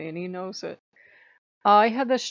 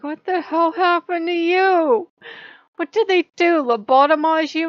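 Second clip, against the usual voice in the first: incredulous shout; 10 semitones higher, 9 dB louder than usual. Retold or imitated. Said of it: "I looked at Odin like".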